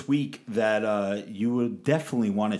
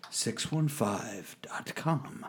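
whisper; 2 semitones lower, 9 dB quieter, much slower than usual. Acted. Whispered website plug.

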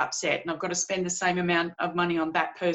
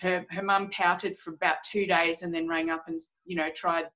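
ordinary talk

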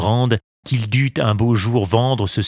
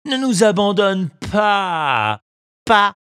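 begging animals; 10 semitones higher, slower than usual. A narrator's reading. Fox and cat begging.

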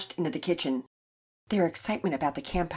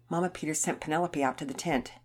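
plain reading voice

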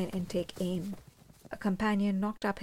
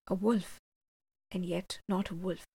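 usual speaking voice

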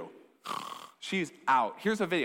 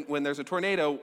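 normal talking voice

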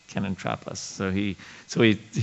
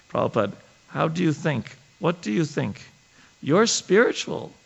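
put-on higher, rising, wide-eyed girlish questions; sweet innocent voice; 6 semitones higher than usual. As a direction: imitate a sweet, innocent young devotee asking her guru. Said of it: "I'm trying to imitate her".

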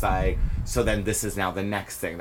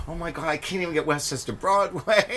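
retold upset; 9 semitones higher than usual. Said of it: "I remember being so upset".

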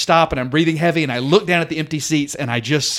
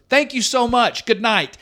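stage host; 6 semitones higher than usual. Retold or imitated. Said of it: "so Joe goes".

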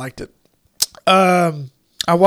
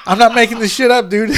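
louder, quicker words through laughter; laughing insistence; 4 semitones higher, 5 dB louder, faster than usual. Real, not acted. Swearing to a friend the plot is real, laughing. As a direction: insist it's true while cracking up.

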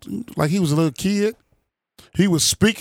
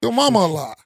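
mock angry comeback; 9 semitones higher than usual. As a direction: shout it back like a fed-up president. Voicing president's comeback.

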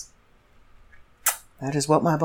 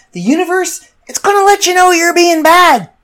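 mock warning; 11 semitones higher, 6 dB louder than usual. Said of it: "I said".

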